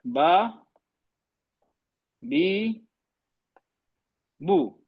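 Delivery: बा, बी, बू said separately, each with the same short length; reading the vowel marks. Shown as a demonstration. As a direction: read बा बी बू with equal timing. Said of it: "अब मैं लिख रहा हूँ यहाँ पे". (बराबर वक़्त देकर बा बी बू पढ़ना)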